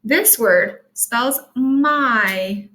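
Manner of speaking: put-on very slow, every word spaced out for children; teacher slowly naming a sight word to first graders; slow teaching voice. Shown as a demonstration. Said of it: "The Y is making a long I sound".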